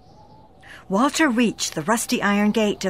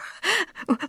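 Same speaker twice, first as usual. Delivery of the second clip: light chuckle; 10 semitones higher, 4 dB quieter than usual. Acted. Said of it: "Laura chuckled".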